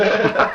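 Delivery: short high giggle; a quick amused chuckle; light laugh. Acted. Matzo man laughing at the goat, quick high giggle. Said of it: "laughed and said".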